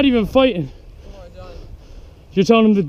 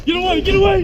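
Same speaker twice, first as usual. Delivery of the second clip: panicked flee; 12 semitones higher, faster than usual. Real, not acted. Fleeing the tagger.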